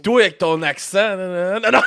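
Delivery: loud, high teasing no no no; teasing shout. Real, not acted. Teasing the cohost about his accent, loud playful shout. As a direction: shout him down, laughing at him.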